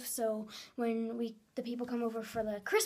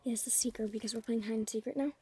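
hushed whisper from inside the closet; whispering while hiding; 3 semitones lower, 6 dB quieter than usual. Real, not acted. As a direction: whisper so the seeker can't hear. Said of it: "I'm hiding in the closet".

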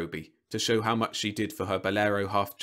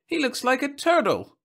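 put-on high, loud child voice; high-pitched kid's taunt; jeering child; 6 dB louder than usual. A narrator's reading. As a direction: pipe up like a kid in a crowd.

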